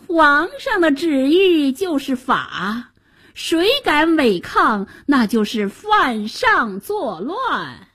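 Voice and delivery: high, slow, drawn-out menace; sly villainous chancellor. A narrator's reading. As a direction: lay down the emperor's law with oily relish. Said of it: "白脸宰相奸笑着".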